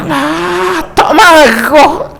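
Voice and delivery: high, drawn-out protest; imitating an exasperated husband. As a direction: stretch out a high-pitched denial of anger. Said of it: "Sikit je angkat suara".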